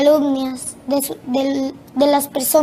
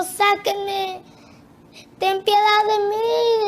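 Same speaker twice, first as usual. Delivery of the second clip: higher-pitched imitated plea, not a full shout; retold crowd's cry; 4 semitones higher, slower than usual. Multitudes in hell begging for mercy, imitated high cry. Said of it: "multitudes de personas gritando".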